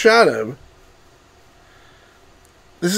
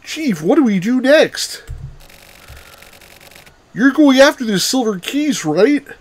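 higher-pitched, lilting eager questioner; her character voice; 6 semitones higher than usual. Acted. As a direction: pitch it up as a put-on woman's voice. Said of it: "I was trying to do her voice".

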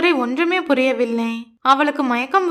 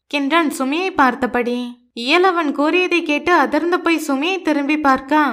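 plain reading voice

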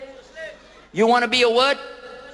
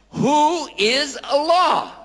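1 rhetorical; 7 semitones higher, much slower than usual. Acted. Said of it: "rhetorical question".

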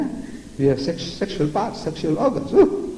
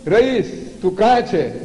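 put-on higher calling out to a hiding child; playful calling; 6 semitones higher, much slower than usual. Grandfather to his hiding grandson, higher playful calling. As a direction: call like you cannot find him. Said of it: "I say".

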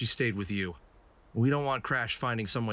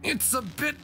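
straining; 13 semitones higher, much slower than usual. Acted. Pushing a stuck panel.

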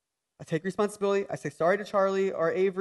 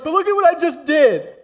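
mock protest; 8 semitones higher, 7 dB louder than usual. Voicing a guilty listener.

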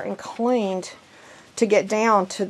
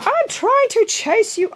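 high, drawn-out singsong to a puppy; high puppy-chasing call; 13 semitones higher, 3 dB louder, slower than usual. Real, not acted. Owner calling after a runaway puppy, high singsong.

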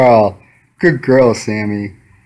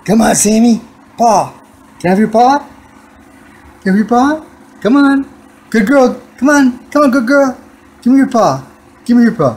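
high coaxing voice for a cat; pet talk; 10 semitones higher than usual. Real, not acted. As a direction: plead with the kitty up high. Begging the cat for its paw.

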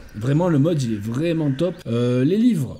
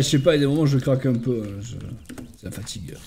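ordinary talk, nothing marked

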